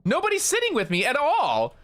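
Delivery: high-pitched retold excitement; all hyped up. Traveller finding nobody sitting near them on the plane, hyped up. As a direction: relive being thrilled, pitch leaping way up. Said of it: "I was, like, all hyped up about my seat".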